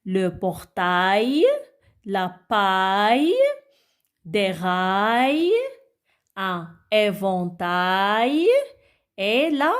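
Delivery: slow word list, each ending stretched; model reading. Shown as a demonstration. Teacher repeats the words for children, slow and measured. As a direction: draw out every final "a-y", mostly lifting at the end. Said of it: "Alors, je répète".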